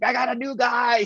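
high, eager mock cheer; like he just won a prize; delighted newcomer greeting; excited. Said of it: "He was so excited".

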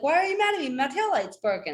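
high-pitched exasperated protest; indignant retelling. Retold or imitated. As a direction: re-enact arguing back at mom, voice way up. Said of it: "You know, like".

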